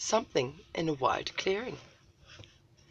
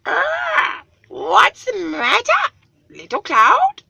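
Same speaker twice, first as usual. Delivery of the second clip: much higher, louder, slow eagle voice; great eagle voice; 12 dB louder, slower than usual. Acted. Eagle speaks down to Little Cloud, high and booming. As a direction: call down like a lofty eagle.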